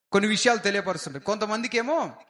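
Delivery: normal talking voice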